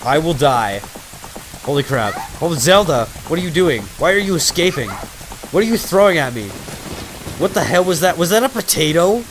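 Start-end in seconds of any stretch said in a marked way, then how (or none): 2.4-9.2 excited. Real, not acted. Yelling at game character.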